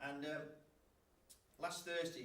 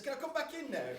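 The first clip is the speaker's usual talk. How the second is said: excluded lad asking; 12 semitones higher, 8 dB louder than usual. Retold or imitated. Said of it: "and says".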